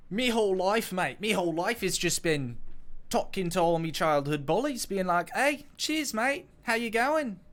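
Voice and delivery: put-on high, loud Australian accent with a sprinkle of British. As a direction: ham up a matey Aussie accent. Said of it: "Sorry for that accent".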